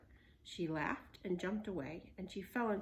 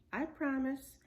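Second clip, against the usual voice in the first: put-on girl voice; 6 semitones higher, 5 dB louder than usual. Annie high reply.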